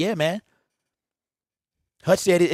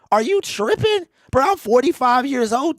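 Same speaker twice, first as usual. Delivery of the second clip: are you serious, squeaked high; incredulous; 7 semitones higher, 3 dB louder than usual. Real, not acted. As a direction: voice jumps way up in disbelief. Incredulous retort to a commenter.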